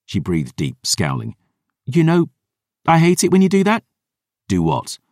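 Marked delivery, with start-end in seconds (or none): 1.8-3.8 put-on higher, irritated young woman; annoyed girl voice. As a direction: huff it out, fed up. Dior's girl voice.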